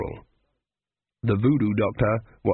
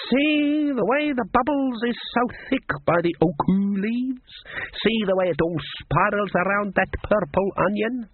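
high, slow voodoo woman; psychic voodoo woman; 9 semitones higher than usual. A narrator's reading. Voodoo woman showing the cook her pot, psychic. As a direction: draw it out as a seer peering into the pot.

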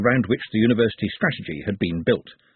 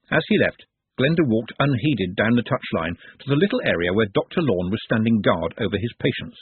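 usual speaking voice

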